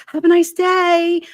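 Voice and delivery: high, loud, drawn-out send-off; exaggerated retold goodbye; fake-cheery farewell. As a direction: sing it out high as a getaway line. Retelling her sign-off before fleeing inside.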